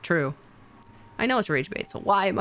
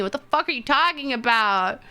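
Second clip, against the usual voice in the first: ordinary talk, nothing marked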